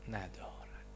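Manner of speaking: breathed, voiceless ندارد; a hushed whisper that lets the point land. Real, not acted. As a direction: drop to a secret whisper for the last word. Hushed punchline in a sermon.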